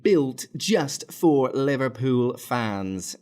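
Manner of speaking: high, slow, loud mock British announcer voice; hammed-up British welcome, drawn out; fake British accent. YouTuber welcoming fans in a put-on British accent. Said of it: "go in and out of my fake British accent".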